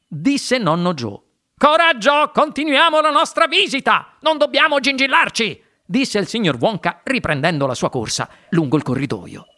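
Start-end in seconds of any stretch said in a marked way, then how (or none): 1.6-5.6 high, energetic urging; rallying. A narrator's reading. Wonka urging visitors onward, high eager rallying call. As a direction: hurry the group along, pitched high.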